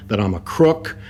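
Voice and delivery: ordinary delivery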